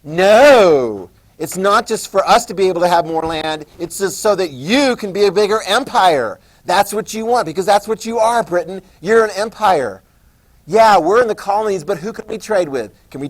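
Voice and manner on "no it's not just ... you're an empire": loud defiant protest; angry colonist, loud and higher. Retold or imitated. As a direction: throw the accusation at Britain. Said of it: "one of their responses is".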